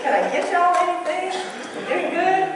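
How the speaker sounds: high, sugary Southern sing-song with a smile; sweet Southern accent. Retold or imitated. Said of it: "with this accent and a smile".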